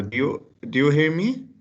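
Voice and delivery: ordinary talk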